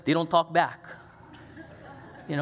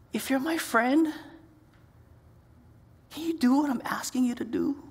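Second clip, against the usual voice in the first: gentle, raised plea as Jesus; Jesus pleading; 8 semitones higher than usual. Retold or imitated. Jesus asking his disciples, a gentle raised plea. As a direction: ask it like a hurt friend. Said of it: "He's saying".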